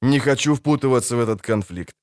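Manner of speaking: plain reading voice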